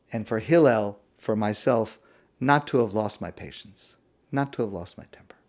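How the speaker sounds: calm Hillel. Acted. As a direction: answer gently, like a father who will not be provoked. Hillel answers the provoker calmly.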